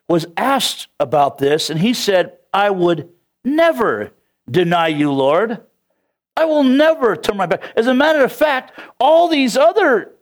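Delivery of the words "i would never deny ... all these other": acted boast, climbing high in pitch; proud, emphatic vow; boastful. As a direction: swear loyalty with puffed-up pride. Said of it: "Peter boasted".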